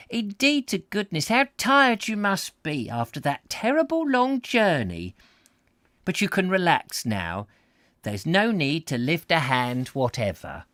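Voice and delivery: high-pitched, fussing sympathy; elderly Welsh hostess, effusive. Acted. Miss Lewis comforts tired guest, effusive Welsh hostess. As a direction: fuss over the tired traveller in a high voice.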